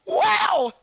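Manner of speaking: high, loud laughing cry of shock; shocked laughing shout. Real, not acted. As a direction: scream it out through laughter. Shocked laugh at the scary sound.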